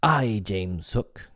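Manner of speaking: slow, deliberate and stern; stern answer. Acted. Peter answers Hook's challenge, slow and stern. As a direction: answer the pirate with slow, steady defiance. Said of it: "came the stern answer".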